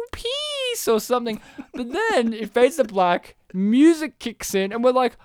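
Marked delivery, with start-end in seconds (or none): 0.1-0.9 very high, soft little voice; girl's voice. Retold or imitated. Podcaster imitates the unclear film voice, girlish. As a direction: squeak it out high and small. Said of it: "in a girl's voice".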